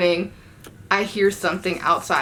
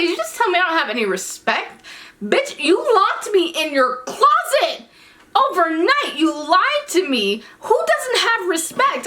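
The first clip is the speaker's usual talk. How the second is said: high, loud, slow indignant comeback; outraged retort; 10 semitones higher, 3 dB louder than usual. Retold or imitated. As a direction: tell him off, incredulous and high. Furious retort at the boy.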